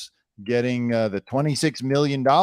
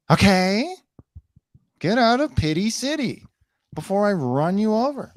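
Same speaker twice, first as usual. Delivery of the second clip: mock CEO voice; 7 semitones higher than usual.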